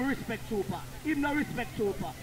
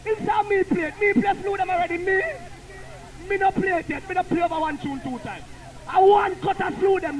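louder, higher and insistent; heated argument; 5 semitones higher, 5 dB louder than usual. Real, not acted. Selector arguing he never played the tune twice. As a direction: defend yourself hotly.

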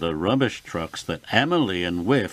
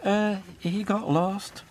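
lightly wheezed, higher-pitched excuse; a hint of a wheeze, pitched up; 8 semitones higher than usual. A narrator's reading. Emily makes an excuse to Elizabeth, mildly wheezy. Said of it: "wheezed Emily".

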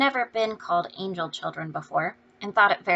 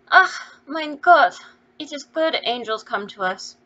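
slow, loud, tearful German cry of relief; poor mother weeping with joy at the angels; 6 semitones higher, 8 dB louder than usual. A narrator's reading. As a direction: sob out her thanks in joy. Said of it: "crying for joy".

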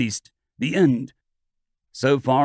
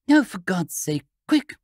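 frightened cry; 5 semitones higher than usual. A narrator's reading. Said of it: "she cried, pale with fear".

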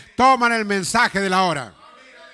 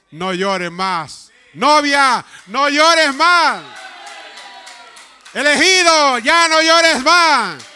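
consoling divine voice; 5 semitones higher, 5 dB louder, slower than usual. Acted. Voice of God consoling bride.

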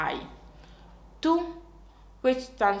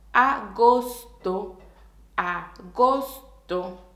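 slow agosto; 4 dB louder, slower than usual. Shown as a demonstration. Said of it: "we're going to learn them slowly".

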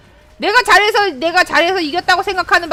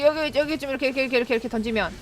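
usual speaking voice

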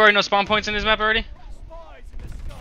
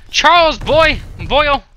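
loud, high call of a name; raised, high-pitched hail; 6 semitones higher, 7 dB louder, much slower than usual. Real, not acted. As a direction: call it out to another player. Calling out a name on screen.

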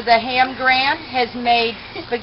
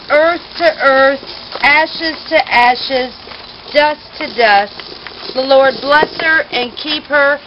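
preaching committal; 3 dB louder than usual. Acted. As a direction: preach it over the grave.

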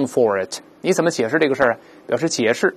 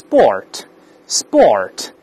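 American sport, tongue hooked back for the r; American pronunciation; 3 semitones lower than usual. Shown as a demonstration. Teacher shows how Americans say it, American r in sport. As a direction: say sport slowly the American way, r sounded. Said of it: "最后要把舌头勾回来".

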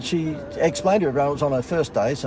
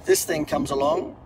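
normal talking voice

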